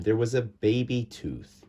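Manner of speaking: plain reading voice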